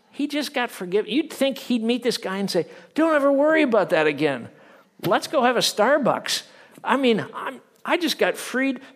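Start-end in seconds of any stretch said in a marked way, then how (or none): 2.9-8.8 high, loud, joyful reassurance; happy release. Pretend. Forgiven servant reassuring his debtor, high and joyful. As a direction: beam as if newly freed. Imagined kind reply of servant.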